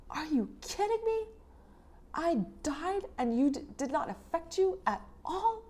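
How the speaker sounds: outraged, high-pitched disbelief; mock the goddess's fury at her husband; furious Inanna. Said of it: "Inanna is furious. She's like".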